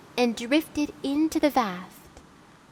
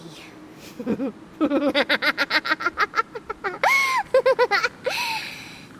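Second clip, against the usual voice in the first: a long, high mirthless laugh; rueful cackle; high bitter laugh; 9 semitones higher than usual. Acted. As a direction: laugh at the irony of it. Bitter laugh at her brother.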